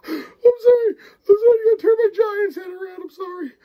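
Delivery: high, crying-laughing sorry; laughing apology. Real, not acted. Reviewer apologising to camera, crying with laughter. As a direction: apologise while giggling uncontrollably. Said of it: "I can't keep a straight face".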